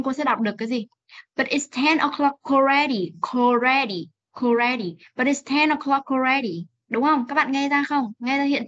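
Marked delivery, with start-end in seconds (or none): 1.4-6.7 "o'clock already" run together as "o'clo-kalready"; fast linked reading. Shown as a demonstration. Teacher shows students linking, joined-up reading. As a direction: link the k of "o'clock" onto "already". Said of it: "Nếu mà cô đọc nhanh".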